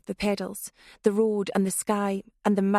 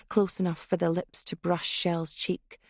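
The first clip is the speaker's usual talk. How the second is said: plain reading voice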